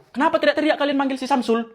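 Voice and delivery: normal talking voice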